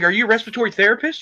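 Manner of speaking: quick sneering rhetorical quiz; fast sarcastic question. Retold or imitated. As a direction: fire it off as a rhetorical jab. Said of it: "I was like".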